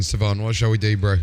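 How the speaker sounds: normal talking voice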